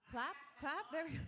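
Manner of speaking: rally the audience; egging the crowd on. Real, not acted. Comic eggs on the crowd.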